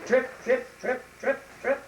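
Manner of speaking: high, crisp trotting patter; trotting hoof steps. Acted. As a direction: tap out each step high and loud. Middle goat's steps on bridge.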